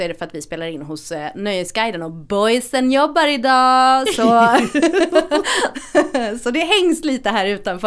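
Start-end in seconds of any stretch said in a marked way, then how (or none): 4.3-6.4 laugh. Real, not acted.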